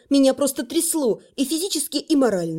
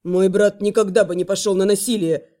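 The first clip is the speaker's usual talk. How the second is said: low male voice, defending; defensive; 6 semitones lower than usual. Acted. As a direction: defend his brother firmly. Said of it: "бросился на защиту Дима".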